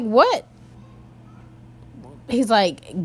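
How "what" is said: high, loud disbelieving what; louder, higher stunned what; shocked what. Retold or imitated. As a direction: blurt it out in disbelief. Recalls shock at his demand.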